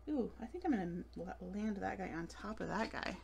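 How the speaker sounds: normal talking voice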